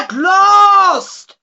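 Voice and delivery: very loud, high monstrous cry; demon's terrible cry. A narrator's reading. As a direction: roar out freed at last. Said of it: "with a terrible cry".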